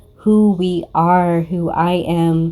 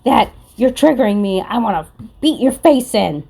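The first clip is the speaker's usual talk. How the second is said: high mock rant of an angry viewer; mocking angry hater; 5 semitones higher than usual. Tarot reader mocking an angry viewer, high mock rant. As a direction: whine it like a triggered hater. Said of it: "they're just like".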